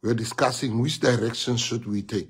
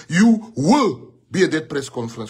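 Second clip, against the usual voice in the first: firm raised-voice order, louder; mock angry command; 4 semitones higher, 6 dB louder than usual. To the angry national chair, a loud firm order. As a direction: insist hard, like ordering him there. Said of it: "I was angry with him".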